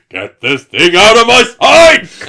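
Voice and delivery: very slow, high-pitched shout; hammed-up impression of an outraged order, drawn out and loud; Hugh Laurie. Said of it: "it's actually Hugh Laurie's voice".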